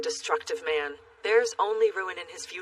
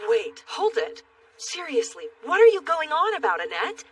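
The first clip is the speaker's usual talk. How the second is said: shocked; 9 semitones higher, 4 dB louder than usual. Acted. Shocked interruption.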